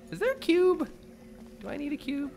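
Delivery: excited high question. Real, not acted. Puzzle search.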